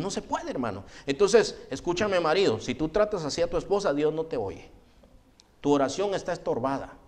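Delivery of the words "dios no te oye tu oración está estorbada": ordinary talk, nothing marked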